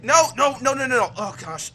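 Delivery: a dad at his wit's end; exasperated father. Acted. Thompson refusing his son in exasperation.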